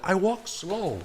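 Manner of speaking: high, mock-pitiful accented complaint; sad gentle giant. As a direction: play the hurt big softy with a Spanish accent. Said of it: "And I had this dude".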